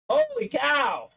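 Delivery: ordinary talk, nothing marked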